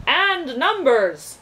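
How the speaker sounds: slow, loud and emphatic; forceful. A narrator's reading. The magician insisting to Milo, slow and loud. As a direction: hammer the point home. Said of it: "added the math magician forcefully".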